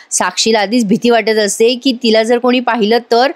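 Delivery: ordinary delivery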